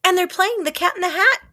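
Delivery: high, loud delighted cry; cried out excitedly. A narrator's reading. Child crying out to the lion, high and loud. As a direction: call out in delight. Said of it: "I cried".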